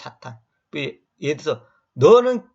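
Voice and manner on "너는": loud, high insulting shout; hurling a nasty insult at someone; mock insult. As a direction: spit the insult out. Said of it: "이렇게 말하면".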